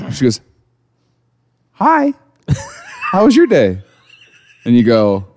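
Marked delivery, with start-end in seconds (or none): 1.7-3.8 high sweet voice of an unknown wife; cheery stranger wife. Pretend. Stranger greets you at home, high and sweet. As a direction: chirp it like a strange woman breezily moving in.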